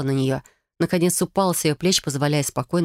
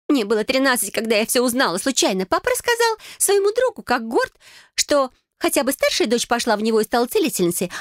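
higher-pitched, wounded recounting; hurt sister; 8 semitones higher than usual. Acted. Sister recalling father calling her a disappointment, hurt. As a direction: relive the slight, voice pitched up.

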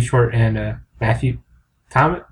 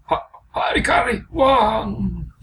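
mock shouting caricature; 8 semitones higher, slower than usual. Mocking reply, high shout.

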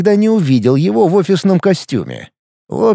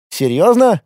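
surprised question; 6 semitones higher than usual. Acted. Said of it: "удивился сотрудник".

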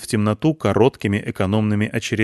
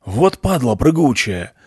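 gruff curse; 3 semitones higher, slower than usual. A narrator's reading. Soldier cursing jumping dog.

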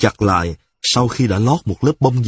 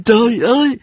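crying out; 11 semitones higher than usual. A narrator's reading. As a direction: yelp Trời ơi way up high, hurt. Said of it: "cô ta la lên".

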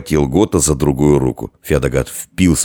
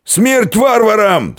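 very high, drawn-out battle cry; stretched, full-voiced yell; Феодогат yelling; 4 dB louder, slower than usual. A narrator's reading. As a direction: bellow a drunken war cry. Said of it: "снова проорал Феодогат".

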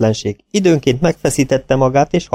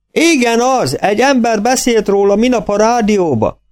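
farmer voice; 10 semitones higher than usual. A narrator's reading. Farmer on phone.

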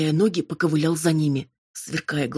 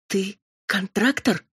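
slow, faltering disbelief; disbelieving babble; 3 semitones higher, slower than usual. A narrator's reading. Amalia discovering her cousin's demon, faltering disbelief. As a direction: falter it out, not believing it. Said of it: "недоверчиво пролепетала она".

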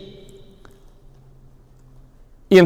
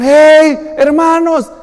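calling out; 7 semitones higher, 5 dB louder, much slower than usual. Retold or imitated. Boy calling from the pit.

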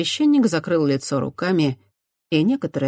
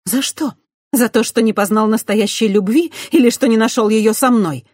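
hurt demand; 7 semitones higher, 7 dB louder than usual. A narrator's reading.

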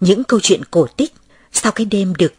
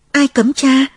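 higher, louder surprised question; indignant question; 7 semitones higher, 5 dB louder, slower than usual. Acted. Child asks the priest who forbids him, surprised. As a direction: ask who would forbid it.

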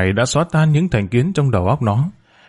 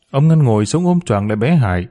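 plain reading voice

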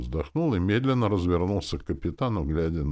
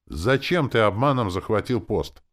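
firm, raised demand; resolute; 6 semitones higher, 6 dB louder than usual. A narrator's reading. Captain demands why he seized the post, resolute. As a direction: ask it loudly and decisively. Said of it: "Дюрекс решительно спросил".

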